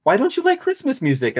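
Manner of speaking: high, imitated wife; lightly mocking high question; wife's voice. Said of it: "she's like".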